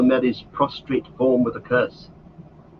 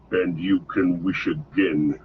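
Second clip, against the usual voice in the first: growling voice; 5 semitones lower than usual. A narrator's reading. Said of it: "said the growling voice".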